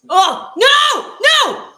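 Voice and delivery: high, loud cry of no; alarmed shout. Real, not acted. Fan watching a near fumble, alarmed shout. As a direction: yell it as disaster nears.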